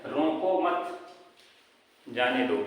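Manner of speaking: "रोको मत" drawn out, pause, then "जाने दो"; pause after मत. Shown as a demonstration. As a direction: hold a clear comma break after "मत". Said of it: "कुछ ना कुछ क्षण के लिए रुकना होता है".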